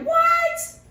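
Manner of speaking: high, loud shocked squeal; stunned high-pitched what. Retold or imitated. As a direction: shriek it in disbelief. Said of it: "And you're like".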